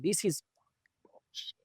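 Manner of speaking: a fed-up aside, breathed not voiced; muttered retold frustration, dropping to a whisper; whispered curse. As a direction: hiss "bullshit" under the breath, voiceless. Retold angry inner thought.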